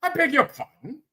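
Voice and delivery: high, swooping caricature of British speech; put-on British accent, poking fun; mock British accent. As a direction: ham up a proper British fuddy-duddy, pitch jumping high. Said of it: "you know how the British do".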